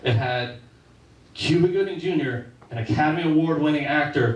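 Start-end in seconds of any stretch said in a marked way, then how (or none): none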